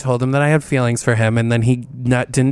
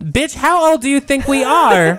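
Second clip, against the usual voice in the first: mock outrage; 13 semitones higher, 3 dB louder, slower than usual. Mock offence at older brothers line.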